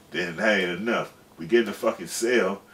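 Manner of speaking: usual speaking voice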